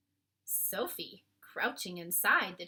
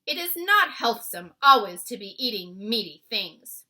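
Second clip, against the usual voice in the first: BFG voice; 13 dB louder than usual. A narrator's reading. BFG lecturing the giant.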